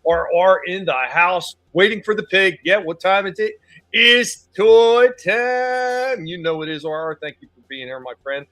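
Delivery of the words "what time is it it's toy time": loud, high, very slow call; drawn-out catchphrase. Acted. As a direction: stretch it like a show catchphrase. Catchphrase to chat.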